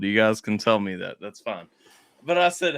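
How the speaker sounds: ordinary talk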